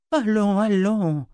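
Zed soothing the waking seer; comforting. Acted. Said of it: "la réconforta Zed". (voix réconfortante et lente)

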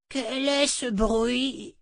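put-on high, drawn-out old-woman cry; startled exclamation. A narrator's reading. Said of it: "s'écria sa compagne". (exclamation aiguë et traînante de vieille femme)

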